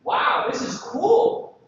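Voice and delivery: high, slow, breathy gush of wonder; hammed-up delight; mock excited user. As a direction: gush like a kid with a new toy.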